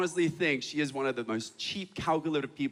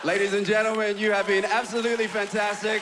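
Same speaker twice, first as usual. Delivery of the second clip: loud, higher stage farewell; rousing sign-off; 4 semitones higher, 3 dB louder than usual. Real, not acted. Comedian closing the show to his audience, loud and raised. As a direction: project a big closing thanks to the crowd.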